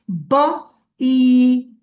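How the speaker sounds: বা then ই held long; long ই drawn out. Shown as a demonstration. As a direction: stretch ই like a long vowel. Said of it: "টেনে বলতে হয়". (ই দীর্ঘ করে টেনে বলা)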